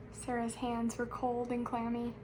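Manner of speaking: ordinary delivery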